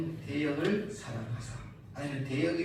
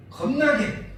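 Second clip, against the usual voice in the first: 겁나게 called out higher and louder; emphatic punchline; 7 semitones higher, 7 dB louder, much slower than usual. Shown as a demonstration. Preacher shouts the punchline word 겁나게 to the church. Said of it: "emphatic dialect word".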